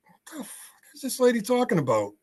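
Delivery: high-pitched incredulous aside; retold bafflement. Retelling his bafflement at the caller.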